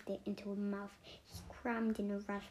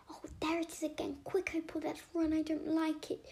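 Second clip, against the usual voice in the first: higher, urgent plea to flee; frightened cry; 7 semitones higher, 4 dB louder than usual. A narrator's reading. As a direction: hurry her friend away in fright. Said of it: "Olivia cried".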